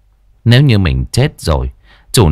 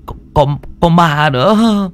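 higher, halting, frightened stutter; stammering fear; 6 semitones higher than usual. Acted. Henchman to boss Tuấn, stammering with fear. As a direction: still shaking, force out the words. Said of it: "lắp bắp".

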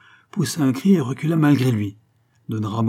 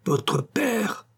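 indignant cry; 12 semitones higher than usual. A narrator's reading. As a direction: throw the words back, indignant. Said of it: "s'écria-t-il". (cri d'indignation)

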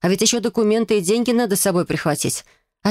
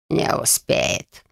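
confident scoff; much slower than usual. A narrator's reading. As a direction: scoff it with certainty. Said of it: "уверенно хмыкнул Конрад".

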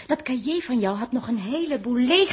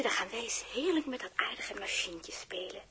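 zacht gefluisterd, lief; fluisteren; 5 semitones lower, 12 dB quieter than usual. Acted. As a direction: fluister het tegen het vogeltje. Fluistert tegen kanarie Toedeltje. (fluistert lief tegen kanarie Toedeltje)

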